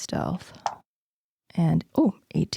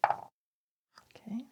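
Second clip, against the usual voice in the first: whispering; 3 semitones higher, 12 dB quieter than usual. Real, not acted. Stealthy player whispering.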